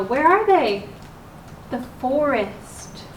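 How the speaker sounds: ordinary delivery, nothing marked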